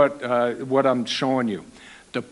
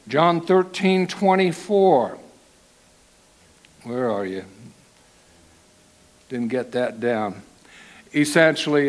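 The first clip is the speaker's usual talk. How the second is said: usual speaking voice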